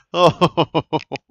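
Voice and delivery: burst of laughter. Real, not acted. Player butchers the kick.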